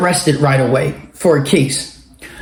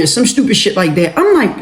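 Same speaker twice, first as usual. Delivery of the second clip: ordinary talk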